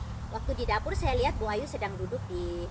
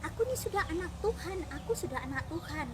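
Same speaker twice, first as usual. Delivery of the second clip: high, pleading retelling of a crier; imitated tearful protest; 7 semitones higher than usual. Crying woman insists she is already God's child, imitated tearful protest. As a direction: protest as if crying, pitch lifted. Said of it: "dia nangis dan dia bilang".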